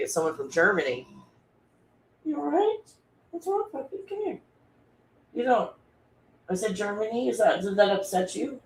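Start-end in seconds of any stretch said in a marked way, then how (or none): 2.2-5.7 high, soft coo to the dog; soothing puppy talk. Real, not acted. Asking her puppy what's wrong, in a high soft coo. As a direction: coo gently at a worried puppy.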